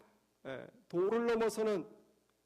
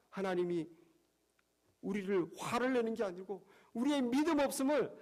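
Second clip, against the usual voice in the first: normal talking voice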